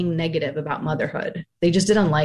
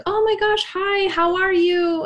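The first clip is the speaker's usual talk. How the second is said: sing-song social greeting; overly bright greeting; 13 semitones higher than usual. Retold or imitated. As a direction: play the fake-cheerful acquaintance. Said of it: "if I ran into you at the grocery store and I was like".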